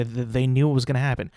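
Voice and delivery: ordinary talk, nothing marked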